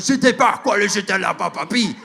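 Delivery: high mock-French tirade; even madder. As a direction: imitate a furious Frenchman ranting. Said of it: "he got even mad".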